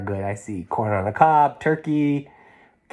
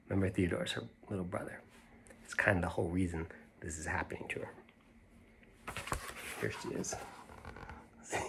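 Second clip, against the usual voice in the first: whispered aside; 5 semitones lower, 18 dB quieter, much faster than usual. Real, not acted. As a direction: lean in and whisper the reminder. Hushed aside to young listeners.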